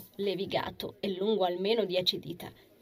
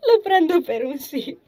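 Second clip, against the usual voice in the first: high, loud, giggly delivery; amused and tickled, voice lifted; chuckling; 8 semitones higher, 11 dB louder than usual. A narrator's reading. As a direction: say it through the tail of a giggle. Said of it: "ridacchiò la ragazza".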